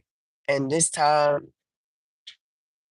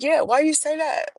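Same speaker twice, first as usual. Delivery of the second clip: surprised; 11 semitones higher than usual. Retold or imitated. Surprised reply to the cop.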